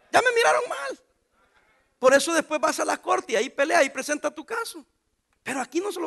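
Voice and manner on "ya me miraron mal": much higher mock-worried aside; playful high aside. Real, not acted. Preacher joking that the crowd is glaring at him. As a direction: squeak it out as a joke.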